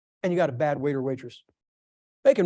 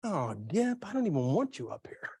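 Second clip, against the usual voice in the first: softer, mildly disdainful God; a hint of a reluctant God; God looking down on the kneeling speaker; God's voice; 6 dB quieter than usual. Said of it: "might have been looking down going".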